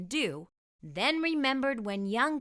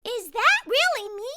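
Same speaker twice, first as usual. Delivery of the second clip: high, louder gasp of wonder; a girl stunned by her own reflection; amazed girl; 12 semitones higher, 6 dB louder than usual. Acted. Vanessa seeing her future self, amazed and high. Vanessa sees herself in mirror.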